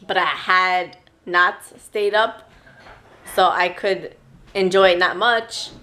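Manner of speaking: ordinary talk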